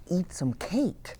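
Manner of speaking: ordinary delivery, nothing marked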